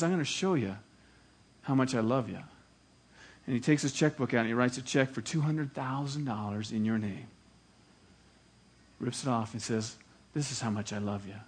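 soft, low and tender. Acted. Judge telling his friend how much he loves him, tender and soft. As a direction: say it gently, full of love.

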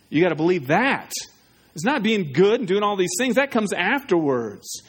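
normal talking voice

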